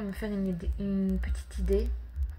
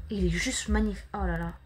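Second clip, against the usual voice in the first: ordinary delivery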